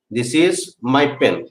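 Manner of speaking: slow down so the class can write it; very slow dictation. Shown as a demonstration. Teacher dictating to students, very slowly. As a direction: space out "this is my pen." word by word. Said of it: "teacher repeats sentence".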